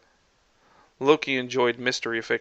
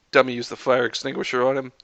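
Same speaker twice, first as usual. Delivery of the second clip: ordinary delivery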